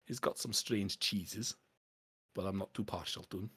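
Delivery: canny Scottish dwarf dealer. Acted. Dwarf pitches smuggling bottles to a collector, canny Scottish dwarf. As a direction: sell the scheme in a Scots dwarf voice. Game master voices dwarf.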